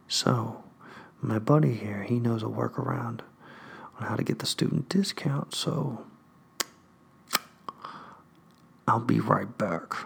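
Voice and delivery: quiet conspiratorial aside; hushed clerk. Retold or imitated. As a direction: keep it secret. Clerk keeps hushing.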